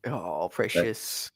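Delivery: slow, soft coo to a pet. Real, not acted. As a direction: coo gently at the dog. Cooing to the pet.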